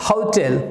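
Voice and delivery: "होटल" as HAU-tel, o gliding to u; "होटल" with a diphthong first syllable. Shown as a demonstration. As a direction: glide the vowel in "होटल", then clear "tel". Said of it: "आपका बन जाएगा अ".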